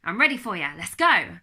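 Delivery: loud, pumped-up battle cry; a big, loud rallying shout to the world; ready for the world. Retold or imitated. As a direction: fire yourself up for the day. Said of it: "suddenly I was like".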